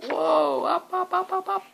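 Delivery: rising excitement, going up and up; excited climb up. Acted. Tower rising, building wonder.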